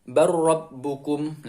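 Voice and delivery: "Bar Rabbukum" slowly, doubled r with no l; lam merged into ro. Shown as a demonstration. Teacher gives second example, lam merged into ro. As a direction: join "Bar Rabbukum" on one long r. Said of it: "Contoh yang kedua".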